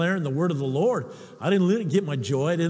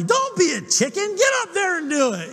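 high-pitched imitation of a wife yelling; wife's yelled dare, playfully imitated; mock yelling wife; 10 semitones higher than usual. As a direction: goad him with a high, mock yell. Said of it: "she starts yelling at me".

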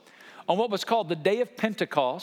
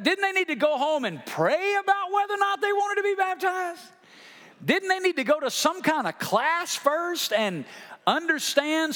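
mock skeptical questioner; 10 semitones higher than usual. Pastor voices a skeptical questioner.

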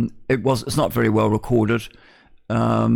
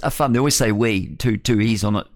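ordinary talk

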